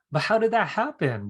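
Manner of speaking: astonished question. Retold or imitated. Surprised reply to the psychic.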